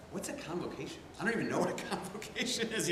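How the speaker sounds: mock-baffled, tickled by the fancy word; playful bafflement. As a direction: act out not knowing, with a chuckle in it. Retold clueless reaction to odd word.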